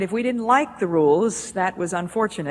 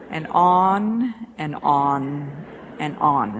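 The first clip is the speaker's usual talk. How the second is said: normal talking voice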